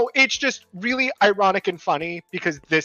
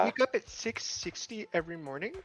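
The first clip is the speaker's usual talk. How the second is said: airy, softer voice, like a dazed star; ditzy celebrity voice; 12 dB quieter than usual. Pretend. Playing a dazed celebrity for friends, airy voice. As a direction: drift through it dreamily. Drew Barrymore bit continues.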